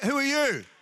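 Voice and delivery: high, loud, taken-aback question; hammed-up incredulous challenge; mock disbelief. Newcomer in heaven questioning the odd voice, mock disbelief.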